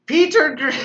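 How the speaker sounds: breaks into high laughter mid-name; cracking up at the comment; laughing. Real, not acted. Host reacting to a viewer's Peter Griffin joke, laughing. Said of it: "You look like Peter Griffin".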